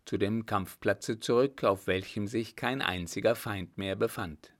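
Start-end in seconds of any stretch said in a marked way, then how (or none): none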